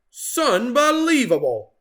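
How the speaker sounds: high, loud astonished cry; surprised exclamation. Acted. Farmer Jake finding Turkey, loud amazed cry. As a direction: burst out in disbelief.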